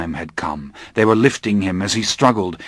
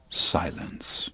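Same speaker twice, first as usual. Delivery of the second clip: whispered; 4 semitones lower, 13 dB quieter than usual. A narrator's reading. Narrator whispers the pause.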